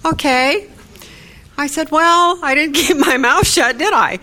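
high, loud, laughing admission; amused outburst. Real, not acted. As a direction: laugh it off, pleased with yourself. Laughing about starting trouble in town.

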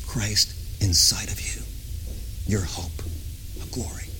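whispered, fading almost to nothing. Real, not acted. Preacher confiding to the saints, whispered and fading. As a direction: breathe it like a secret. Intimate climax of sermon.

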